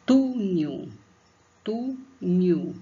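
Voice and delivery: "Túnel" with the first syllable "Tú" punched loud; stress on TU. Shown as a demonstration. Teacher shows pupils the stressed "Tú" in "túnel". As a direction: say "túnel" hitting "Tú" hard. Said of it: "Então, tu".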